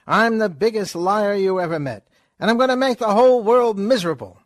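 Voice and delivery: fast, high, loud wisecracking patter; Groucho wisecrack. Acted. Groucho lecturing Chico about the presidency, fast and loud. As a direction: rattle it off like a vaudeville con man.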